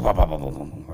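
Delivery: loud, low engine wah-wahs; imitated engine start. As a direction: imitate a big truck engine cranking over. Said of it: "all of a sudden, it's like".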